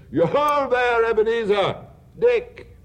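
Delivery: rich, jolly calling-out voice; jovial voice. Acted. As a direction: call out big and hearty. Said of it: "comfortable, oily, fat, rich, jovial voice".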